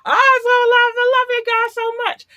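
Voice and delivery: squealed high and loud; overflowing, high-pitched gush; squealing love to the fans. Real, not acted. Gushing love to listeners.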